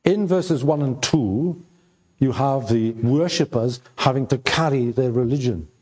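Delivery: ordinary talk, nothing marked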